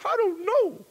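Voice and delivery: a helpless sobbing answer; crying. Retold or imitated. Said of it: "and I started crying".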